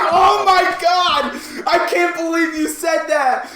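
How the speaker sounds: high, shouted retelling of shock; loud incredulous outburst; shocked disbelief. Retold or imitated. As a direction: shriek it slowly, scandalized and delighted. Shocked reaction to guard's insult, retold.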